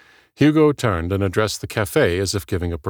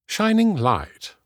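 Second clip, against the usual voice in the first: high, slow British proclamation; British accent; 13 semitones higher, slower than usual. A narrator's reading. Hugo translating Ellie's name, high, drawn-out British charm. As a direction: pronounce it grandly in a British accent. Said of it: "had to have a British accent".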